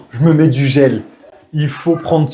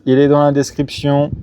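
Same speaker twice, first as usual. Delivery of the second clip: ordinary talk, nothing marked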